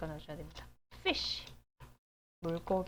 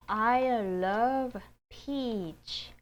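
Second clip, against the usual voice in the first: very slow, louder I love peach; slow model reading; 3 semitones higher, 5 dB louder, much slower than usual. Shown as a demonstration. Teacher models sentence slowly for class. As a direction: read it out slowly for learners. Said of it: "한번 읽어보도록 하겠습니다".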